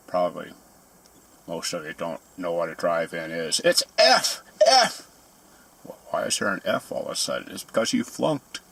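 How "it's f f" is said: high, loud mock teacher shout; squeaky mock announcement of an F; mock grader. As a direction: yell out the failing grade.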